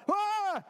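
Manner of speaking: high, loud cry of shock; startled scream. Acted. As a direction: yelp in sudden fright. Sudden fright in the boat. (erschrockener, hoher Aufschrei)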